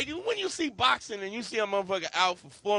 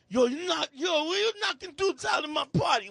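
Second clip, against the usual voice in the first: high-pitched shouted complaint; acted outraged promoter; 9 semitones higher than usual. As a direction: yell like an indignant party host. Angry promoter's quoted complaint.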